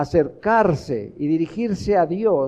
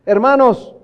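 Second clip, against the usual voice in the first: high, raised pulpit exhortation; forceful preaching; 5 semitones higher, 5 dB louder than usual. Real, not acted. Preacher pointing brothers to Scripture, forceful preaching. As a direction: preach it hard, punching the key words.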